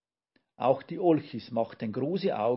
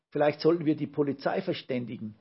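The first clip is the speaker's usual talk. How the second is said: plain reading voice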